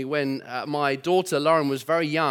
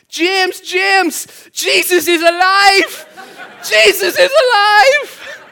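put-on much higher, louder, slow child's joyful yell; shouting child, much higher and louder, overjoyed; excited shout; 12 dB louder, much slower than usual. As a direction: call across the park to a friend, thrilled. Child shouts news to friend.

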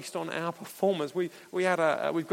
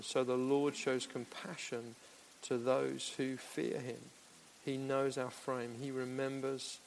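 hushed scripture reading; 4 semitones lower, 9 dB quieter than usual. Acted. Preacher to the church, quoting the psalm in a hush. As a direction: lay each word down softly.

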